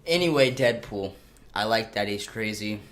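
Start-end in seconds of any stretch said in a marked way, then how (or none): none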